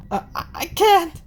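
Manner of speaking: high, stammering refusal; too scared to try; panicked stammer. Acted. Senri voiced.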